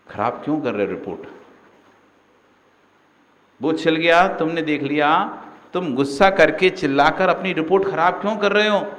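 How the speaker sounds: ordinary talk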